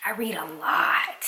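breathy whispered aside. Real, not acted. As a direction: whisper it like a secret. Repeats line as a whisper.

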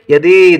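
यदि mispronounced "yadee" with a held ending; wrong long ee. Shown as a demonstration. Teacher warning learners of a misspelling, यदि drawn out as "yadee". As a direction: stretch the end of यदि into "ee". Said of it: "बड़ी की मात्रा लिखते हैं तो".

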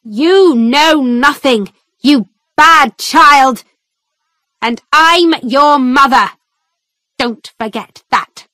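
a narrator's hammed-up angry mother; shouted at daughter. A narrator's reading. Mother shouts at her daughter in court, mild mock shouting. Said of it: "shouted at me".

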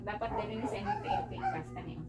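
ordinary talk, nothing marked

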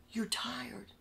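breathy mock-sympathetic whisper. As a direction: whisper it like fake pity. Bosses feign concern for him.

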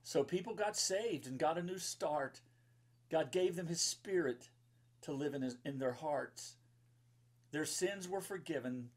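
bright, sing-song rhyming read-aloud; lilting storybook verse; reading to children. Acted. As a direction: read the rhyme to a room of kids. Reads rhyming children's book.

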